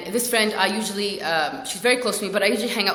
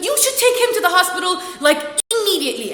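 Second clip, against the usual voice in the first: higher, louder urgent order; insistent urging; 9 semitones higher, 5 dB louder than usual. Retold or imitated. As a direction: press the point with exasperated force. Urgently tells friend to go.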